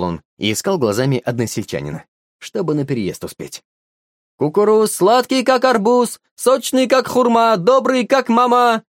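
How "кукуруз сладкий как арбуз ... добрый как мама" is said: high, loud singsong hawker's cry; street vendor calling out. A narrator's reading. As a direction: shout it out to the whole market. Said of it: "выкрикивал долговязый смуглый мужичок".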